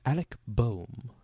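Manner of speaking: slow, posh British pronunciation; posh British accent. Shown as a demonstration. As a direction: say the name like a posh Brit. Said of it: "that was posh as hell".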